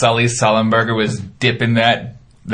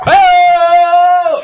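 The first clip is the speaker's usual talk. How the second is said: huge high wrestling battle cry; 9 dB louder than usual. Retold or imitated. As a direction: roar it like a wrestler charging. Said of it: "just do a battle cry".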